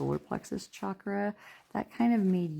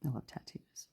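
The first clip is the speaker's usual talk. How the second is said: soft whisper; hushed little aside to yourself; 10 dB quieter than usual. Real, not acted. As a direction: murmur it under your breath. Aside about loving tattoos.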